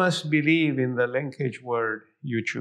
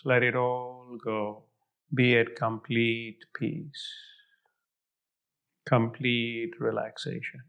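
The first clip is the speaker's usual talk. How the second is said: right in your ear; 3 semitones lower, slower than usual. Acted. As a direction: ease them into calm, close up. Begins guided relaxation, eyes closed.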